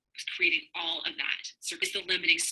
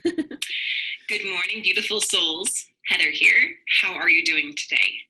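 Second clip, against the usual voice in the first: upbeat vlog host hello, louder; bright greeting; 4 dB louder, slower than usual. Acted. Host greeting her viewers, an upbeat bright hello.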